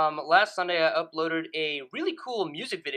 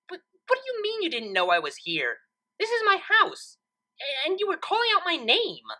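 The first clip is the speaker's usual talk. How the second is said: much higher, incredulous and exasperated; indignant Twilight; 11 semitones higher than usual. Acted. Twilight protesting to Trixie outside her own house, incredulous. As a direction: sputter in disbelief at the nonsense.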